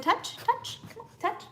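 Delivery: a high, soft coaxing "touch"; coaxing dog command. Real, not acted. Owner coaxing her dog to touch the food, high and soft. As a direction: sing the command up high to coax him.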